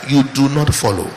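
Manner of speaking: ordinary talk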